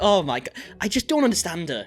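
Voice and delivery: ordinary delivery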